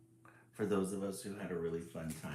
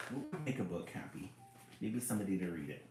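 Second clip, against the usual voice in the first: ordinary delivery, nothing marked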